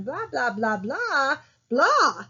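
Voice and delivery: imitated baby babble, "blah" repeated with the last ones swooping high and falling; babble with sentence stresses. Teacher imitating kids babbling, "blah" stressed like real speech. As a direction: babble "blah" in a sentence's cadence, punching the final "blah". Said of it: "they're putting the stresses in the right spot".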